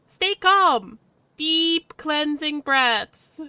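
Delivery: high, loud, drawn-out self-coaching; forced calm. Acted. Player steadies their nerves, forced calm. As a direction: stretch out the soothing words, tensely.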